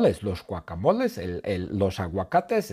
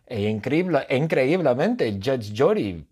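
plain reading voice

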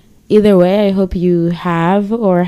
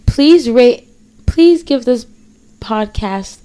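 energetic retake; 4 semitones higher, slower than usual. Real, not acted. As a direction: push the request with energy. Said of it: "No, I can't even talk".

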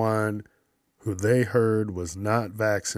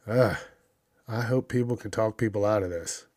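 ordinary talk, nothing marked

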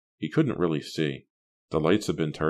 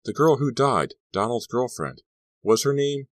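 raised, higher pleading question; a son's raised, higher question to his father; urgent question; 8 semitones higher, 5 dB louder than usual. A narrator's reading. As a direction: press him for the name. Son asks father urgently.

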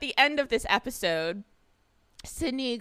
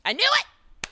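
high, loud burst of vindication; triumphant; 5 dB louder, slower than usual. Real, not acted. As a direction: crow it as your guess comes true. Her guess is confirmed.

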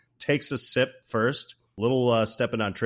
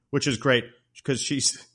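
usual speaking voice

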